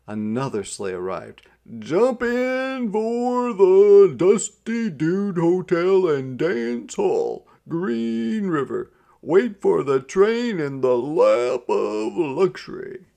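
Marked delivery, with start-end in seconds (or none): 1.8-13.0 loud, high, slow sales call. A narrator's reading. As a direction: drag out the pitch to lure riders. Driver calling out to passengers.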